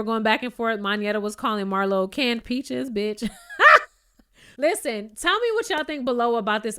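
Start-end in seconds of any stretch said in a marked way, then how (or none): 3.3-3.8 sudden high shrieking laugh; real squealing laugh; loud burst of laughter. Real, not acted. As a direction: crack up at the joke. Laughing at canned peaches insult.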